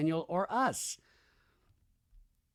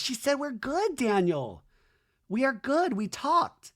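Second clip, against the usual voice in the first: high-pitched retold protest; imitated insistence; 10 semitones higher, slower than usual. Wife assures Daniel they are good, mock insistence.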